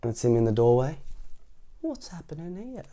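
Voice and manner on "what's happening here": soft, higher-pitched wondering; private thought. A narrator's reading. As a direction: think it aloud under your breath. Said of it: "he thinks".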